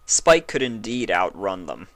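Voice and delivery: ordinary delivery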